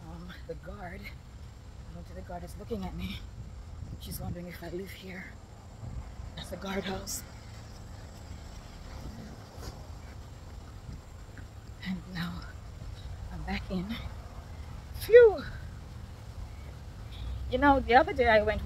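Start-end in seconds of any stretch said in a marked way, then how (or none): 0.0-14.0 hushed aside while sneaking past a guardhouse; hushed past the guard. Real, not acted. 15.0-15.4 relieved phew. Real, not acted. Relief after slipping past guard.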